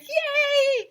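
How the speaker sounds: high, loud yay; joyful cheer; teachers cheering. Acted. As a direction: cheer like a crowd.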